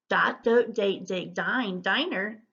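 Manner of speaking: word list run together; brisk fluency demonstration; quick word drill. Shown as a demonstration. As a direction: rattle through the words without pausing. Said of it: "see if you can say 'em quickly".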